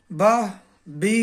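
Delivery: slow syllable drill. Shown as a demonstration. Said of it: "لہذا اسے پڑھیں گے".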